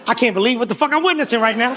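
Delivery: ordinary talk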